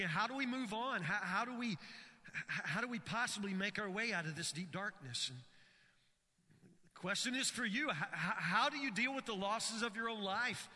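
urgent sermon voice; impassioned preaching. Acted. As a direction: preach it to the pews. Preaching at full force.

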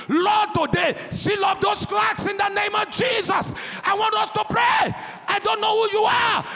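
loud, rising prayer cry, shouted to the congregation; full-force preaching. Acted. Preacher leading the church in prayer over marriages, full-force shouting.

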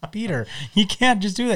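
a hint of a stern wife; mild mock scolding; quoting Lois. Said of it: "And she goes".

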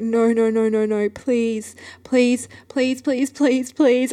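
high, whimpering mock begging; near-tears pleading. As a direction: re-enact desperate begging on the verge of tears. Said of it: "on the verge of tears".

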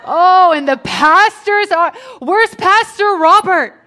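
loud, high congregant's whine; hammed-up protest at being shortchanged; mock indignant complaint. Congregant asking after the absent pastor, mock indignant.

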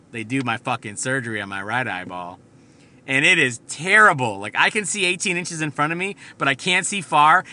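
ordinary delivery

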